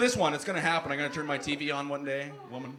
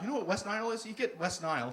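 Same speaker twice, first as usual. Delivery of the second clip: ordinary talk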